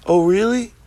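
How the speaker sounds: high, rising challenge; incredulous questioning tone; mock disbelief. Mock challenge to doubters, first take.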